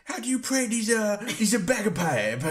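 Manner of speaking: put-on high comic accent, halting; botched Scottish accent. Joking about bagpipes with friends, botched Scottish accent. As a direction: fumble along in failed Scottish. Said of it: "doing my best Scottish accent".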